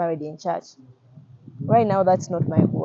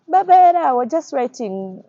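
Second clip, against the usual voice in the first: a mocking caller's jeer, high and loud on the name, then slow; imitating people who call us beggars; mocking others; 7 semitones higher, 9 dB louder than usual. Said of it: "That's how they say it".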